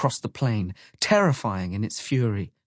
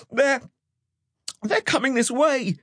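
put-on high-pitched panicky child character; frightened stammer. A narrator's reading. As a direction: stammer the warning in high alarm. Frightened child sees monsters approaching.

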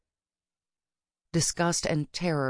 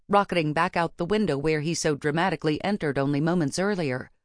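usual speaking voice

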